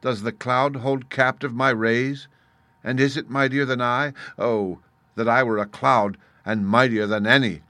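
loud, high angry outburst; angry cry. A narrator's reading. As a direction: shout it out in anger. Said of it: "he cried in his anger".

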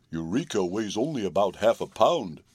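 horse voice with a touch of scorn; mildly dismissive, in a horse character; scornful. A narrator's reading. Horse answering the girl about the kitten, mildly scornful. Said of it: "in a scornful tone".

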